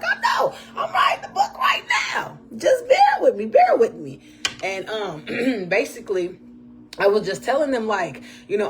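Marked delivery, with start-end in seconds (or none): 0.0-4.2 very high, loud, cry-like squeal; squealing excited reply. Retold or imitated. Answering churchgoers who praised her testimony, excited squeal. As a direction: squeal it out like an overwhelmed star. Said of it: "I'm like".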